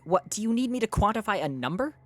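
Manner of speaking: loud, high-pitched indignant question; exasperated challenge. Real, not acted. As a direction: throw it back at chat, loudly. Arguing with chat over the book.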